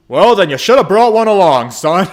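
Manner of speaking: big, loud booming father voice; boisterous dad rallying his boy; hearty dad. Acted. Father cheerfully pushing son into a cave trip, booming loud.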